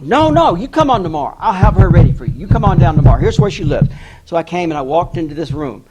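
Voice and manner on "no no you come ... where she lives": loud, eager daughter insisting; anxious insistence. Retold or imitated. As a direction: push them to come, loud and raised at first. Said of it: "she was really anxious for me to get there".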